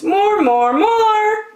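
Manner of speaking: high, loud cheer; cheering crowd. Acted. The audience cheers Bear on, loud and high. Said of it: "cheered the audience".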